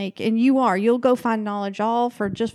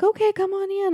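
high, sugary welcome; sweet hostess; 10 semitones higher than usual. Retold or imitated. Sweet woman letting the fleeing victim in, high and sugary. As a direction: welcome them in like a sweet lady.

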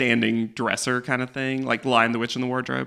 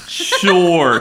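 sarcastic; 3 semitones higher, 5 dB louder than usual. Acted. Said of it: "Chris replies sarcastically".

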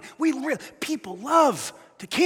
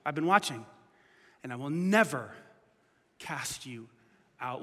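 gentle reassurance; 7 semitones lower, 7 dB quieter than usual. Retold or imitated. Jesus answering the sinner, softly reassuring. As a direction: fade to a hush on out.

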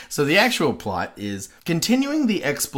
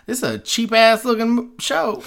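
mocking exclamation; 10 semitones higher, 4 dB louder than usual. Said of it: "My first notes were".

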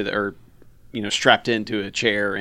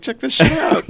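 much higher bragging mock voice; cocky dog; 12 semitones higher than usual. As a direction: show off, hammed up.

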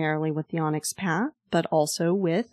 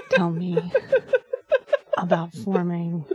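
sultry. Pretend. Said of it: "you sound like you're aroused by the concept".

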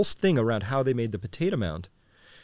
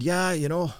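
higher-pitched imitation of an Irishman; Irish accent; 5 semitones higher than usual. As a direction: lightly put on an Irish brogue. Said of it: "had this thick Irish accent".

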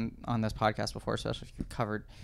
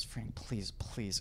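quiet pleading; 3 semitones lower, 11 dB quieter, much slower than usual. Retold or imitated. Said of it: "Rickles goes up to him and goes".

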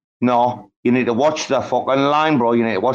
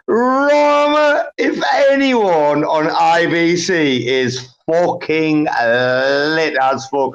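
excited shout; much slower than usual. Real, not acted. Hyped up, yelling to Rama that they're the best. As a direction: crack the voice shouting with pride. Hyped boast about their Space.

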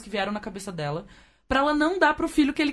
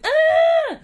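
put-on high loud child's scream "Ah!"; sudden piercing kid's cry; child's wail; 8 dB louder than usual. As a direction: shriek it like a desperate toddler.

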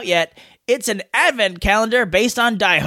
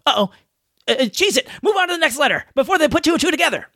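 mock panic; 6 semitones higher, faster than usual. Joking panic, hurry to next letter.

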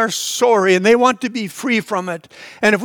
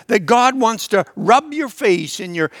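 normal talking voice

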